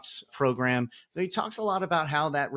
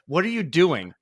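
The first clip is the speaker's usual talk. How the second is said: louder, higher mock exasperation; imitated coach's challenge; 4 semitones higher, 6 dB louder than usual. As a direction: retell the coach's challenge with force. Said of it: "I remember you keep telling me".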